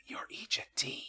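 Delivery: whisper. Real, not acted. Aside while reading rules.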